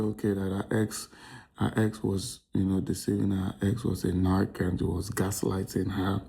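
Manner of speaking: ordinary delivery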